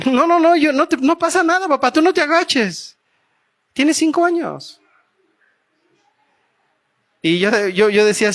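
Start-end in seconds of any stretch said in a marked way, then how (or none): 0.1-4.8 put-on much higher, eager little-girl insistence; a loving daughter who won't take no; insistent daughter. Daughter insisting to her father, high and eager.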